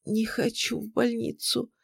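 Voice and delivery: frail, high and soft, near tears; weak voice. A narrator's reading. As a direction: plead feebly in a small, high voice. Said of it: "Она сказала слабым голосом".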